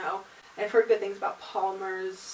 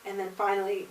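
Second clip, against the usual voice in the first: usual speaking voice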